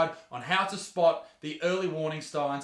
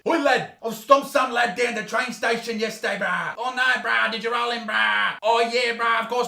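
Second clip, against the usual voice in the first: loud, higher mock Aussie eshay lad banter; eshay lads chatting; 4 semitones higher, 5 dB louder than usual. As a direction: ham up two loud street lads bragging. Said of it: "you might hear the following conversation".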